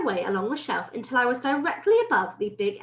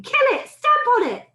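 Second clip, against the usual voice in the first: high, loud urgent orders; shouting; 13 semitones higher, 7 dB louder than usual. A narrator's reading. Cooks trying to stamp on the mouse, shouting. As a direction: yell it high as a command to the mob. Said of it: "they were shouting".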